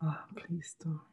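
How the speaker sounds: whispered mock plea; breathy whisper. Prank caller whispers teasingly to the man on the phone.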